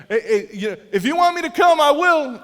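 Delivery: high, loud shout across the water; calling out. Retold or imitated. Peter shouting to Jesus across the water, loud. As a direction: call out to someone far away.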